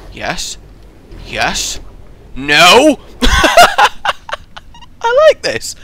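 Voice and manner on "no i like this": high squeal breaking into a laugh; squealing with delight. Real, not acted. Gamer squealing at his viewers, high and laughing. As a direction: shriek then giggle at the puzzle.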